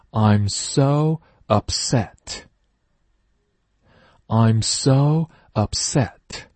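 slow teacher model sentence; slow loud reading. Shown as a demonstration. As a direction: read it slowly for the class. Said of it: "一起大声朗读".

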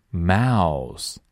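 ordinary delivery

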